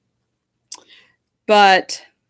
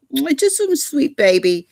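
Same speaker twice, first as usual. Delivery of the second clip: pet talk; 7 semitones higher than usual. Real, not acted. Cooing over the dog.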